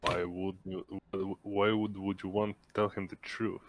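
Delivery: ordinary talk, nothing marked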